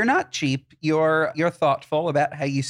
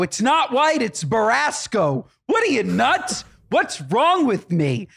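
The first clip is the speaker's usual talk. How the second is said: higher, indignant and fussy; indignant correction; 7 semitones higher, slower than usual. Retold or imitated. Snobby owner correcting the coordinator, indignant. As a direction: correct her like it's obvious. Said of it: "I go".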